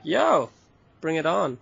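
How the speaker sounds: high, loud, eager cheer; eager yes. Retold or imitated. To a friend suggesting unripe papaya soup, an eager, loud cheer. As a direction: jump at the idea like it's great news.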